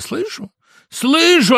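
high, wailing reply; tearful voice. A narrator's reading. Rooster answering at mention of hens, tearful voice. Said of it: "отозвался петух плачущим голосом".

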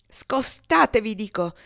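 loud, high, slow furious command; angry outburst. A narrator's reading. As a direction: bark it out in rage. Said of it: "esclamò Adolfo con ira". (ordine furioso e gridato)

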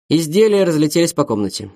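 plain reading voice

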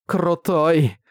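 bright admiring exclaim with a smile; smiling. A narrator's reading. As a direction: say it grinning, impressed. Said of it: "улыбнулась Каска".

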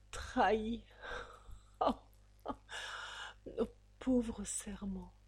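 a stunned whisper. A narrator's reading. A betrayed girl whispering to herself. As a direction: breathe out the word in hurt.

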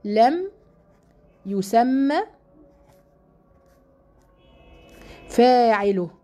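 each word alone with long pauses; word-by-word dictation. Shown as a demonstration. Teacher dictating to her class, word by word. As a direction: dictate it slowly for students to write. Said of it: "اسمه فعل إيه؟".

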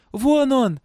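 high, loud cry of discovery; shout. A narrator's reading. Girl spotting her father, shouting. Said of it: "крикнула девочка".

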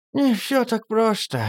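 put-on high, slow sighing old woman; aunt Zosya sighs to Dima, high and slow. A narrator's reading. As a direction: sigh it out wearily. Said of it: "вздохнула тётка Зося".

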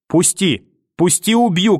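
high shout building to убью; grandmother shouting. Acted. Grandmother screams at the mother to let go, rising. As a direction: scream the threat. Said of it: "кричала где-то в темноте бабушка".

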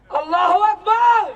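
high, loud cry of Allahu Akbar; a rally cry over the crowd; ecstatic cry. Acted. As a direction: throw it out high and full. Chanting cry in protest poem.

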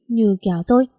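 ordinary delivery

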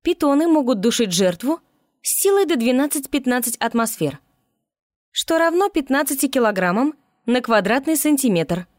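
high, eager little report voice; high-pitched keen kolobok. A narrator's reading. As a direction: announce it like an eager little know-it-all. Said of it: "доложил он".

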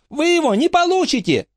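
очень высокий злорадный выкрик; подразни их из укрытия; крикнула. A narrator's reading. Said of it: "крикнула она". (Фрэнки выкрикивает с вызовом)

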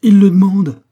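loud, high outcry; anguished exclamation. A narrator's reading. Distraught lover answers Gorju, anguished outcry. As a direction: cry it out in indignant distress. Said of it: "s'écria-t-elle en se tordant les bras".

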